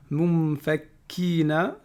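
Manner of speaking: Mumfakkina very slow, kaf held double; doubled kaf. Shown as a demonstration. Teacher drilling the shadda on the kaf, slow. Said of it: "shadda sur le kaf".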